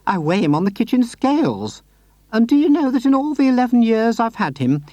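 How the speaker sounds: put-on high, sweet old lady voice; Mrs Silver voice. A narrator's reading. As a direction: lift into a gentle, doting lady.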